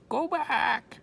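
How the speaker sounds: high, loud parrot squawk. A narrator's reading. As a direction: screech it like a bird. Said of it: "squawked Polly".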